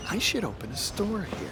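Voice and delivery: a private thought, half-voiced; quiet aside. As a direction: say it under your breath. Merchant's aside to himself.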